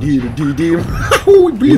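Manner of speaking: laughing through a hummed riff; real laughter breaking the song. Real, not acted. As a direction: giggle out the tune, then squeal. Sings, laughing.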